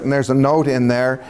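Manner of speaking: ordinary talk, nothing marked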